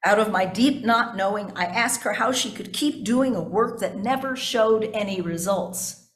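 normal talking voice

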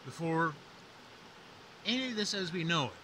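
dramatic legend narration; myth teller. A narrator's reading. Myth retold, higher and louder.

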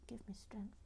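muttered under her breath; whispered exasperation. Real, not acted. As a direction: sigh it out in a whisper. Exasperated aside.

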